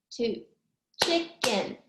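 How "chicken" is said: CHICKEN in two separate beats; syllable by syllable. Shown as a demonstration. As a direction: count out CHICKEN as CHICK, then EN. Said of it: "How many syllables?".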